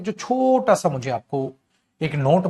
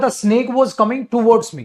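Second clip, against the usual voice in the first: slow, higher and louder quoting; slow louder dictation; slow emphatic; 6 semitones higher, 5 dB louder, much slower than usual. Shown as a demonstration. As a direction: read each word out loud and slow. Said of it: "तो हमने बोला था".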